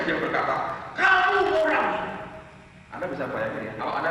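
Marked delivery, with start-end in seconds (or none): 1.0-2.1 high, drawn-out mock yell, falling at the end; loud, slow cry imitating an emotional old man; imitated tearful shout. Grandpa suddenly stands and points at the young preacher, shouting. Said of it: "Dia teriak dengan mata berkaca-kaca".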